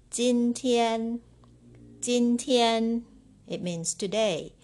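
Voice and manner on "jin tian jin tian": jin tian said high and loud, twice; model pronunciation. Shown as a demonstration. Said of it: "Number ten".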